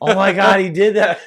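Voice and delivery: loud, high excited exclamation; excited disbelief. Real, not acted. Buddy reacts to the wild play, excited disbelief. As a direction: blurt it out, thrilled.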